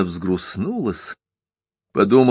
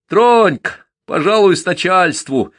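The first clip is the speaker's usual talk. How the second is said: much higher, louder cheeky jeering; gleeful mockery; 9 semitones higher, 4 dB louder than usual. A narrator's reading. Lad jeering at the peasant, gleefully mocking. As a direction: taunt him with delight. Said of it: "весело издевался над мужиком Стёпка".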